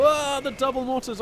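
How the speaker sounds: excited outburst. Real, not acted. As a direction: burst out at the action. Sudden exciting moment in casting.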